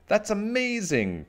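high, drawn-out wonder; excited cry. A narrator's reading. Cherry admires Little Witch's flying, crying out in wonder. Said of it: "cried Cherry".

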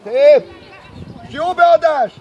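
loud, approving sideline call; shouted praise. Real, not acted. Coach praises a good cross, shouting. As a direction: call out praise across the pitch.

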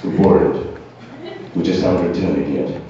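low, understated British voice; British accent. Acted. Comic playing the British excusing themselves, low British accent. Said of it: "when I say it in the British".

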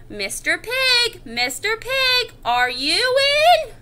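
slow, high singsong call. Acted. Wolf at the brick house, drawn-out calling out. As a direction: stretch each call toward the house. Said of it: "I called".

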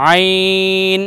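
আইন drawn from the throat; Arabic letter. Shown as a demonstration. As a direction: stretch আইন. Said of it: "প্রথমটা হলো". (আইন টেনে উচ্চারণ)

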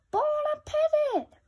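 very high, loud ghoulish cry; gleeful skeleton. A narrator's reading. Skeletons about to devour the boy, very high and gleeful. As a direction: savour it like a hungry monster.

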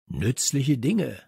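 plain reading voice

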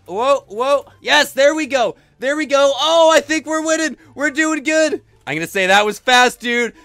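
loud, high triumphant cheering; excited. Real, not acted. Gamer racing back in front, loud excited shouting. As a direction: call it out, thrilled by the lead. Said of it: "I'm excited".

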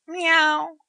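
a cat answering the woman; cat's meow. A narrator's reading. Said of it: "he said".

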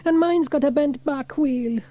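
put-on high-pitched child complaint; boy's groan. A narrator's reading. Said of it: "groaned James".

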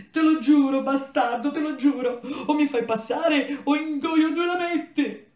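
high, loud hysterical cry; desperate screaming. Acted. Rejected boy screams threats at the serpent, hysterical. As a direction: shriek the oath like a cornered kid.

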